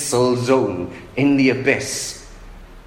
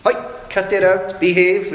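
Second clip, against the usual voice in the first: telling off; 8 semitones higher than usual. Acted. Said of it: "telling off the wind and the waves for being naughty".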